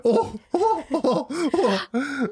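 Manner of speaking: high giggling, character cracking; laughing out of the act; breaking into laughter. Real, not acted. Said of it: "your accent keeps changing".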